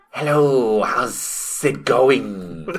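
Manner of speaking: very slow, hissing snake man; drawn-out s like a snake. Pretend. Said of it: "he comes out".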